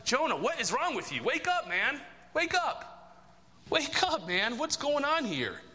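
high, pleading wake up, man; exasperated rebuke. Retold or imitated. Preacher scolding Jonah before the congregation, high and exasperated. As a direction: shake Jonah awake in disbelief. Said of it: "you're like".

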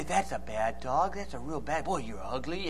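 very soft, low dog-coaxing voice; sweet coaxing tone. Shown as a demonstration. Preacher coaxing a dog with insults, soft sweet tone. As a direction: coo insults gently as if they were praise. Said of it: "animals recognize the tone of your voice, not the words".

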